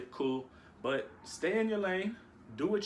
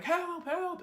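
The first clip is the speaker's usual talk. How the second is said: mock cry for help; 14 semitones higher than usual. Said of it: "And I'm screaming".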